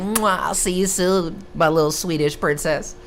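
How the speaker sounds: imitating his sweet farewell to the Swedish girl; mock tender goodbye. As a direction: blow a kiss and coo it playfully. Kissing goodbye to Swedish girlfriend.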